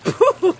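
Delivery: disgusted puh. Acted. Tasting the awful water.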